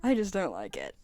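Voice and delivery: ordinary talk, nothing marked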